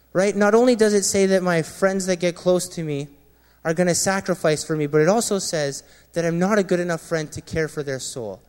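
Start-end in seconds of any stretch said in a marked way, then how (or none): none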